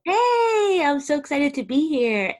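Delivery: high, bright hey; excited greeting. Real, not acted. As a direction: burst in with an excited hello. Guest greets host excitedly.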